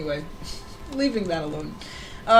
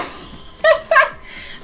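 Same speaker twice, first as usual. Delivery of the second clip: breathless squealing giggle; high giggle; 8 dB louder than usual. Real, not acted. Streamer laughing as raiders pour in, high giggle.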